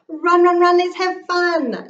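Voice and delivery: high, loud sing-song call; excited chant to race. Acted. Child calling out to animal.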